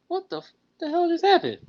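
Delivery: mock shocked cry, pitched far up; stunned disbelief. Driver recalling his reaction after a passenger insulted him, high stunned disbelief. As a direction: replay the moment it sank in, high and incredulous.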